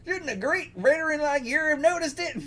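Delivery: high-pitched put-on scolding; chiding character voice. Beggar chiding the great veteran, high character voice.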